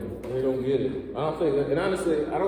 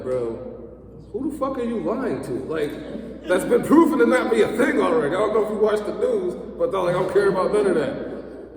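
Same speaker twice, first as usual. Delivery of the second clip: inner rant; 7 semitones higher than usual. Retold or imitated. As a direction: vent at the liar in your head. Inner rant at maskless man.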